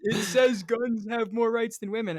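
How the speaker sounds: put-on dopey impression of a protester; mocking protester. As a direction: recite it as a clueless protester would. Mimics lady reciting her sign.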